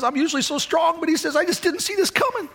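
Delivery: high, climbing, tearful retelling; retold crying. Preacher voicing his grieving friend on the phone, tearful. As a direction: imitate a strong man giving way to tears. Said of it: "he's crying pretty hard".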